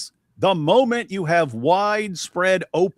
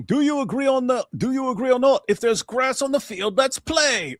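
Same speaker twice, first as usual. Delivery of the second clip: Ali G impression; 10 semitones higher than usual. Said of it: "he starts doing his saying".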